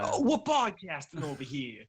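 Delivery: put-on New York accent. Host repeats his opening line in a hammed-up New York accent. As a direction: play up a mock New York Italian. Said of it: "Doing the best New York accents this episode".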